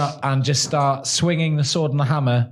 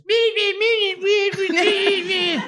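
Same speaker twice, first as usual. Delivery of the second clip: squeaky me me. Acted. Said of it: "And I just".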